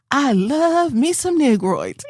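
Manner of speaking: high, slow sweet lady voice; imitating the lady. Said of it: "This lady over here said".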